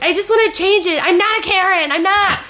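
high, fast whiny mom impression. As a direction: whine like a fussy mother. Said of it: "the mom's like".

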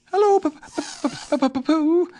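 very high, stammering small animal; Piglet. A narrator's reading. Piglet greets Pooh at the door, high stammer. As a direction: squeak it, stuttering on the name. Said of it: "said Piglet".

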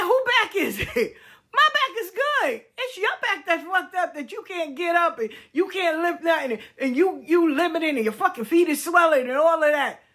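high, incredulous laughing mockery; mocking laugh. To her ex-husband refusing the back doctor, high mocking laugh. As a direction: scoff at him, way up high, cracking up.

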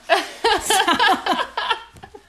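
loud high laugh; giggling fit; snorting laughs. Real, not acted. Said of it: "did I just snort".

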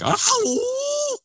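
high drawn-out howl; imitated Bigfoot howl. Describing the night's howl to the host, a long high howl. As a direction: let out a long eerie animal howl. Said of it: "a more deeper, prolonged howl".

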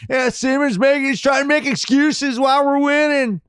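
bad Texas accent, high and loud. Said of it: "It might be the worst Texas accent ever".

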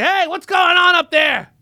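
high, loud shout up the stairs; grandma calling up. Retold or imitated. Host imitating his grandmother calling upstairs, high shout. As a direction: yell up like a nosy grandmother. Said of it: "She was like".

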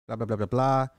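usual speaking voice

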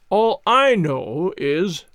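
slow, loud and firm; loud slow assertion. Acted. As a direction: assert it loudly and slowly. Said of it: "loudly asserted".

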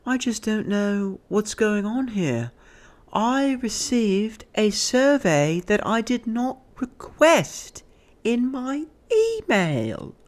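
high, slow British complaint; British lady. Retold or imitated. British lady complaining about a survey, high slow British voice. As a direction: draw every word out in mock British. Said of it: "She had a British accent".

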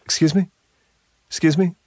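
high, light tough guy "Excuse me?". Retold or imitated. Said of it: "the guy in the bar who's like".

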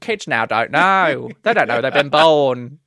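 slow, loud mock old lady; old biddy. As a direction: drag it out like a complaining old biddy. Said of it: "we are so those two ladies".

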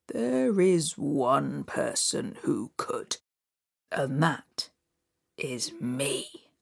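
slow low stranger voice; low looming threat. Acted. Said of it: "said the stranger".

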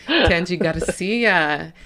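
Tangi Garcia drawn out slowly, every syllable stretched; slow grand announcement. Shown as a demonstration. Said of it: "right on cue".

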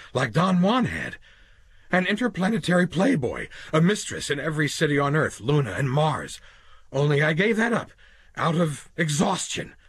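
a cornered man talking fast to save himself; desperate Quayle. Acted. Quayle offers his fantasy.

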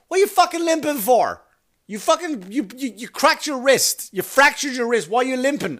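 high-pitched, incredulous telling-off; retold rant, pitched right up in disbelief; exasperated scolding. Dad scolding his limping son over a wrist injury, exasperated.

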